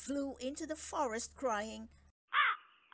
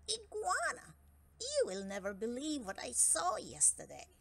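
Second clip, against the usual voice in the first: mosquito voice; 4 semitones higher than usual. Acted. Mosquito gossips to iguana.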